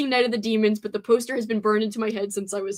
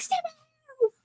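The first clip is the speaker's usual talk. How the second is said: high, intense yell; movie character quote; 4 dB quieter than usual. Retold or imitated. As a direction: fire it off high. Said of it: "he's like".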